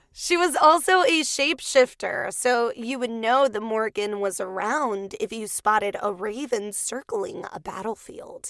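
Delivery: ordinary delivery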